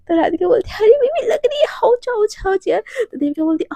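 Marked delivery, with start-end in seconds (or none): 0.8-2.8 high-pitched yelping protest, easing off; play-acted ouch. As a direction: yelp as pillow blows land. Protests being hit with pillow.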